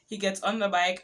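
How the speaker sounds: ordinary delivery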